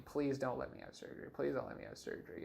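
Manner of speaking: quiet retold plea to God; hushed pleading prayer. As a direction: pray under your breath, pleading.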